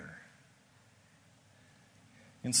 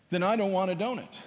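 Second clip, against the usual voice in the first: defiant refusal; 8 semitones higher, 7 dB louder, faster than usual. Retold or imitated. Player refuses the donut.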